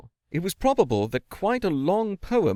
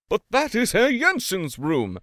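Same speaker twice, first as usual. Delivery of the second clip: wailing; 7 semitones higher than usual. A narrator's reading. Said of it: "wailed the landlord".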